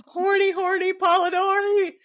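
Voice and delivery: high, loud mock-scandalized cry; high gleeful teasing call; teasing exclaim. Real, not acted. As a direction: tease him gleefully.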